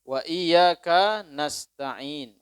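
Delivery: "iyyaka" slow and loud, the kaf kept apart from "nasta'in"; separated kaf. Shown as a demonstration. Preacher recites the verse slowly and loudly. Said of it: "Kalau misalnya disambung kapnya".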